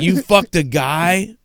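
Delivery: put-on high, slow, accusing girlfriend voice; a hint of a jealous girlfriend; angry girlfriend. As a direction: play the betrayed partner, shocked and hurt.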